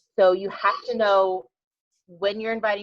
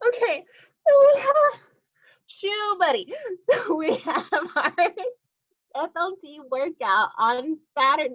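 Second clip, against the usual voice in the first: still laughing; 9 semitones higher, 4 dB louder than usual. Real, not acted. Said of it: "swallowed a fly".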